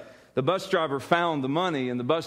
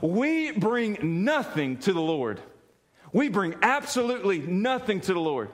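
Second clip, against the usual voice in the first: preaching; 5 semitones higher than usual. Acted. Pastor to his congregation, building sermon fervor. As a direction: drive the gospel home to the church.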